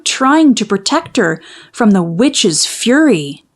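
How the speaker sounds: ordinary delivery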